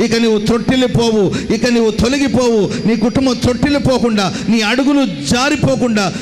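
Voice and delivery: full-force blessing over the congregation; fervent preaching. Acted. (ఆవేశంగా ఆశీర్వాద ప్రకటన)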